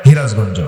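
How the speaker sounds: হিরাজগঞ্জ with h for স; Sirajganj pronunciation. Shown as a demonstration. Preacher joking that Sirajganj people drop the s, হিরাজগঞ্জ. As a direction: say হিরাজগঞ্জ, h instead of s. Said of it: "সিরাজগঞ্জে দিন তো স নাই".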